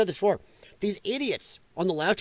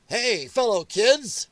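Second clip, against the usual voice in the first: slow, higher, trying-too-hard greeting; drawn-out fake chummy voice; mock fellow-kid act; 4 semitones higher, much slower than usual. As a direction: parody someone desperate to seem cool. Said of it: "that type of crap".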